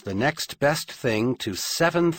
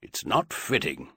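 sour; 5 semitones lower, 3 dB quieter than usual. A narrator's reading. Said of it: "just a bit sourly".

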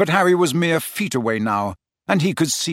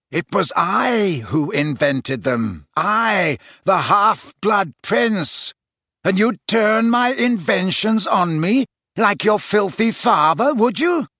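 slow, emphatic rage rising high; furious; 6 semitones higher, slower than usual. A narrator's reading. Snape reveals himself as the half-blood prince, furious. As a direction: build from controlled menace to raised fury.

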